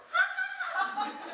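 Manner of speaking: a high hearty laugh burst; sudden laugh. Retold or imitated. Retelling the tongues night, a sudden high laugh. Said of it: "막 이러더니".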